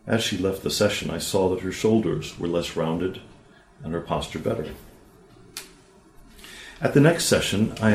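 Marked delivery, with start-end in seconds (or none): none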